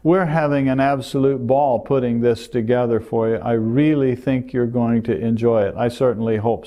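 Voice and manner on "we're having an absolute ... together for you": ordinary talk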